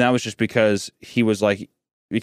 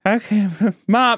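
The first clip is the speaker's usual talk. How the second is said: put-on nervous child; 9 semitones higher than usual. Scared boy approaches his hiding mom, higher and uneasy. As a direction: coax it out warily.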